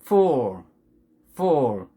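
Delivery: "full" drawn out with falling pitch and dark l; two similar English words. Shown as a demonstration. Teacher testing learners' ears, careful English vowels. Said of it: "두 개 구분이 가는가".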